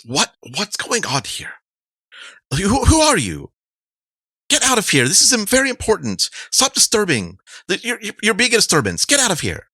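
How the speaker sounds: very high, agitated woman's voice; indignant woman. Acted. Woman ordering an intruder to stop disturbing, indignant and high. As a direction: order them out in a high-pitched, rushed fluster. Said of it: "The woman says".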